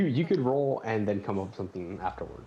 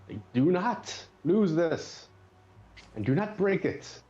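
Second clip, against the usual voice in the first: higher, slow, emphatic plea as a reluctant lender; stern warning in character; 8 semitones higher than usual. Acted. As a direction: warn them firmly, pitch pushed up. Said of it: "Frank looks at you".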